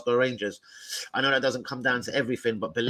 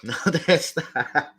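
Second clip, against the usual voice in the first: ordinary talk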